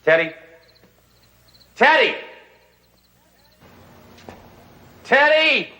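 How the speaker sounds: calling out. Acted. Calling for missing Teddy.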